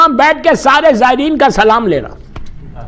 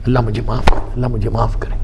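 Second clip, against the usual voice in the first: hushed plea; 6 dB quieter, faster than usual. Retold or imitated. Repeated plea for forgiveness.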